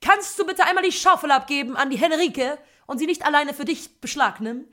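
high, loud playground-parent call; hammed-up exasperated parent; parent scolding child. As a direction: pretend to tell off your little one across the sandpit. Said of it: "Vielleicht nenn ich mein Kind so".

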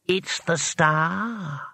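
spell's voice. A narrator's reading. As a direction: intone it slowly as an ancient spell. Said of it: "said the spell".

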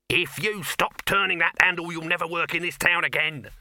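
loud, high, furious warning; shouted threat. A narrator's reading. Dibbler threatening the handleman's job, loud and furious. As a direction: shout the threat without a breath.

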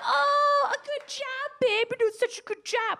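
high, sugary cooing voice; cooing praise. Retold or imitated. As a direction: coo it like praising a toddler. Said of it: "words of affirmation".

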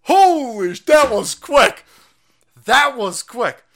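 loud, high burst of astonishment; amazed shout. Real, not acted. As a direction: holler it in real amazement. Reacting to the played clip.

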